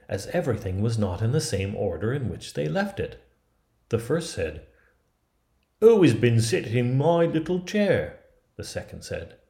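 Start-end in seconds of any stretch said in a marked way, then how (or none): 5.8-8.2 high, loud dwarf character voice; high-pitched storybook dwarf, demanding to know; indignant dwarf. Acted. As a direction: pipe up loudly as a put-out little dwarf. First dwarf finds his chair used.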